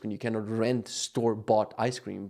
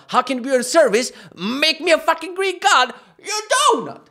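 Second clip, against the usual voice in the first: furious rant; 10 semitones higher, 6 dB louder than usual. Real, not acted.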